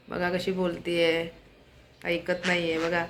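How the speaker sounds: soft, slow and careful; hushed talking. Real, not acted. Vlogger keeps quiet for bird Anamika, hushed. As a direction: keep it down, taking your time. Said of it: "अनामिकाला आवडत नाही जोराने बोललेलं".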